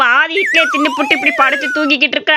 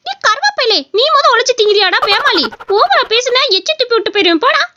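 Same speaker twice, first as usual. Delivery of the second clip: higher, sassy crow talking back; cheeky crow voice; 6 semitones higher than usual. Acted. Crow taunting the boy who scolded it, in a higher cheeky voice.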